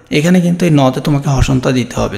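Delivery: ordinary talk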